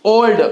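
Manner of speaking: "ओल्ड" with a long, rounded O. Shown as a demonstration. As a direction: draw out the O in "ओल्ड". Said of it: "मजहूल sound होगा थोड़ा सा". ("ओल्ड" में लंबा ओ)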